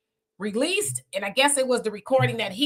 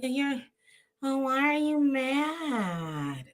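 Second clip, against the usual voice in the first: put-on drawn-out girly "why are you mad"; young girl's voice; 3 semitones higher, 5 dB quieter, much slower than usual. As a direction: imitate her sounding young and puzzled. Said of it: "she does sound really young".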